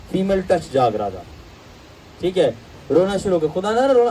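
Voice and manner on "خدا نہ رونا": mock scolding. Scolding a crying believer.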